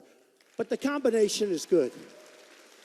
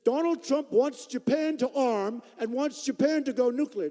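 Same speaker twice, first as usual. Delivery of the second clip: slow, higher, louder mock press voice; mocking press quote; 5 semitones higher, 4 dB louder than usual. Quoting the press about him, slow mock sneer. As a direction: sneer it like a hostile reporter. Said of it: "they say".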